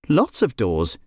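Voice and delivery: usual speaking voice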